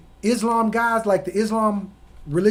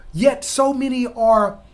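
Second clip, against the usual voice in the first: usual speaking voice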